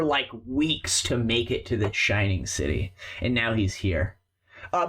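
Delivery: normal talking voice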